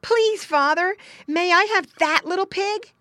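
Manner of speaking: put-on high, loud child's plea; pleading boy. Boy asking father's permission, pleading. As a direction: beg like a hopeful small boy.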